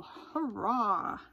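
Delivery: drawn-out storybook cheer. A narrator's reading. Splat reaches the penguin pool at last, cheering. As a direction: let out a happy cheer for the story. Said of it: "cheered Splat".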